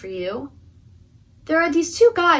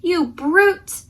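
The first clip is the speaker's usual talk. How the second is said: loud, higher cry of defiance; brave damsel; 6 semitones higher, 5 dB louder than usual. Acted. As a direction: confront the dragon boldly. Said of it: "a brave damsel".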